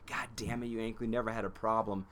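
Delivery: ordinary talk, nothing marked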